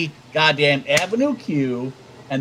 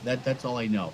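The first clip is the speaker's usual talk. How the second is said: usual speaking voice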